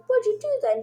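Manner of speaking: high-pitched imitation of a startled kid; mock indignant question. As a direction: ask it high, as a retold protest. Voicing Finn's startled question.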